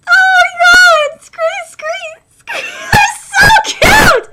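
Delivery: high, loud, slow squeal; gushing. Real, not acted. Streamer squealing at cute creatures, loud. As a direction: squeal over cuteness.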